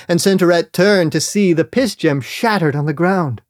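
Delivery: ordinary delivery, nothing marked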